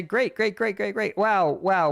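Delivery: high, hammed-up gleeful "great"s; mock delight. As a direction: play sly glee at the enemy's mistake. Mock Ukrainians faking alarm.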